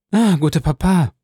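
concerned granddaughter's exclamation; calling out. A narrator's reading. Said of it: "rief sie".

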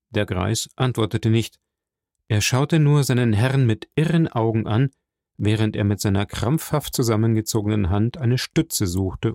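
plain reading voice

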